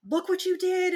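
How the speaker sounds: high, loud mock yell at her daughter; mother yelling at toddler over broken cup, mock scream; retold scream. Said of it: "And I was screaming".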